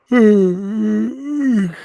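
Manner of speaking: long exasperated sigh; heavy sigh. Real, not acted.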